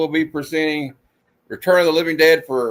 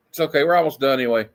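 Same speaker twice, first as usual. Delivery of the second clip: normal talking voice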